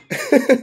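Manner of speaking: short high giggle; high laugh. Real, not acted. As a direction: burst out laughing. Laugh after high line.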